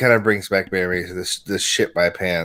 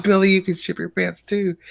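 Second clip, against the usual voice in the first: mother voice; 11 semitones higher than usual. Retold or imitated. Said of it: "she talks to a little kid".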